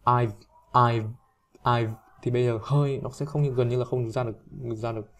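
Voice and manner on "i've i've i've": "I've" cut off with the v barely released; lips closed on v. Shown as a demonstration. Teacher drills the unreleased v, "I've" cut short. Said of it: "nó là mím miệng lại nhé".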